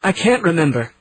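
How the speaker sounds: high, rising wail of despair; a mild wail of despair. A narrator's reading. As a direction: cry it out helplessly. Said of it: "her voice was a wail".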